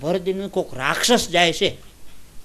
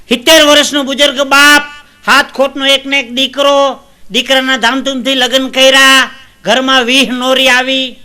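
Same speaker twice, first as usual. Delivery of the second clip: high, loud stage oratory; declaimed storytelling; 7 semitones higher, 8 dB louder than usual. Acted. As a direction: proclaim each phrase like a folk orator. Launches a tale in declaiming voice. (ઊંચા બુલંદ અવાજે કથાકથન)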